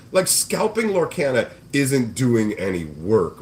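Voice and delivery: ordinary talk, nothing marked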